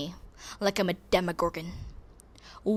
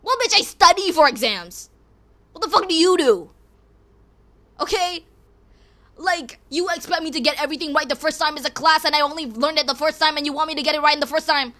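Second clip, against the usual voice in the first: higher, louder rant; fed-up outburst; angry rant; 5 semitones higher, 6 dB louder than usual. Real, not acted. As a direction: tell the critics off, heated.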